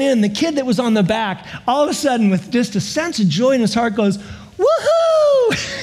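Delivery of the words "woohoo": put-on little kid's woohoo. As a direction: let out a giddy little-kid cheer. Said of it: "it was so little kid".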